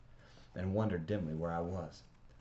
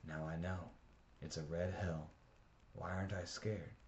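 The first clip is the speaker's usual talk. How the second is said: inner thought; 7 dB quieter than usual. A narrator's reading. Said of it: "and I thought".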